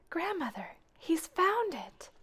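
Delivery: breathless whisper, high and slow; urgent whisper. Acted. Maggie peeking into the dining room, whispering to Grandmother. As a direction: hiss the alarm so no one hears.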